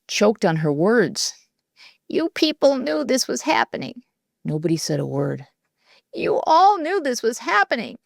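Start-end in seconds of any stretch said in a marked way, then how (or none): 2.1-4.0 higher, voice trembling with hurt; a wounded teacher barely holding it together; shaky hurt voice. A narrator's reading. Teacher confronting the silent class, voice shaking. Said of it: "Her voice shook as she". 6.1-8.0 higher, trembling disappointment; hurt, shaking. A narrator's reading. Teacher repeating the accusation to the class, trembling. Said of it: "Her voice shook as she".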